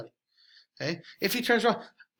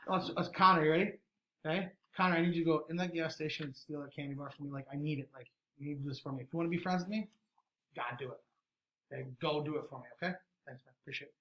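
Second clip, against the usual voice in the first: manipulative friend; 2 semitones lower, 6 dB quieter than usual. Shown as a demonstration. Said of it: "I'm manipulative".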